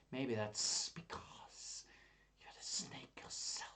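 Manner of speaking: slow, whispery, drawn-out s sounds; hissing snake tease. Pretend. Teasing a listener who liked the snake, hissed whisper. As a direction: tease softly with a sly hiss.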